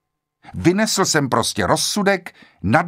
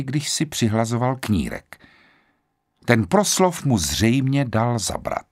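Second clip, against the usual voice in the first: usual speaking voice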